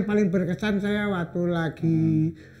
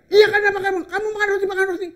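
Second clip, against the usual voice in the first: scolding soldier; 13 semitones higher, much faster than usual. Retold or imitated. Soldier voiced scolding, high pitch.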